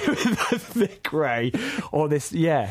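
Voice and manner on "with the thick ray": laughing through the words, slowed down. Real, not acted. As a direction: say it while cracking up.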